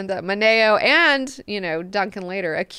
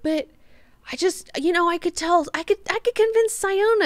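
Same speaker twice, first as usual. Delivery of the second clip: breathy high Hwi voice; 11 semitones higher than usual. Acted. Hwi answering Leto in book retelling.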